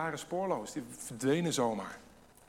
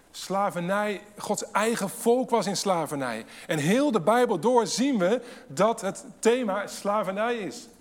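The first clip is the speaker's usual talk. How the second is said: loud, raised preaching voice; preaching at full force; 6 semitones higher, 7 dB louder than usual. Real, not acted. Preacher stressing slavery to the church, loud and urgent. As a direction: drive the point home to the congregation.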